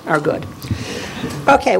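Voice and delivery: ordinary delivery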